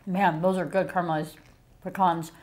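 talking while chewing food; mouth full, chewing. Real, not acted. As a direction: hand covering the mouth. Seen 1: Left woman chews behind her raised hand during the words.

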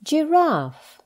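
normal talking voice

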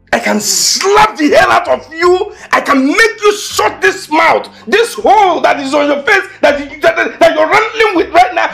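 angry macho threat; furious threat. Acted. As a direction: threaten her with rising fury. Boyfriend threatens girlfriend.

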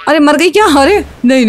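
ordinary talk, nothing marked

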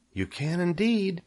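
high, small reply; little spiders. A narrator's reading. Spiders assure Wilbur, high little voice. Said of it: "said the spiders".

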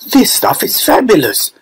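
put-on high-pitched boyish delight; high, relishing little-boy voice; gloating greedy boy. A narrator's reading. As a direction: gush in a high child's voice. Greedy boy gloating over chocolate.

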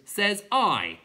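clown creature voice. A narrator's reading.

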